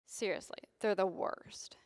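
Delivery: a hushed, joking whisper; whispered aside. Real, not acted. Pastor confides to congregation, whispering. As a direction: lean in and whisper it. Sermon aside about beggars.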